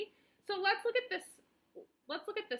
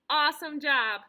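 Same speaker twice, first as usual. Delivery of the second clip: loud cheering awesome job; loud praise; 10 dB louder than usual. Real, not acted. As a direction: cheer them on. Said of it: "praising the child".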